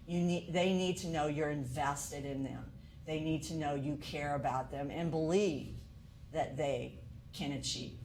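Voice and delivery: ordinary talk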